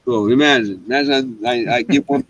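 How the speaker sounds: ordinary talk, nothing marked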